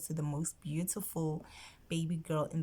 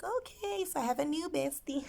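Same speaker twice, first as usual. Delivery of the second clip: delighted squeal; 11 semitones higher than usual. Retold or imitated. Learning baby is a girl.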